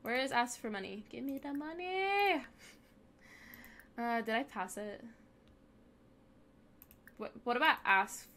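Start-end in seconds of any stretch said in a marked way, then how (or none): 1.1-2.4 slow, higher pushy demand; hammed-up beggar shouting for cash; mock demand. Demanding money from Sim.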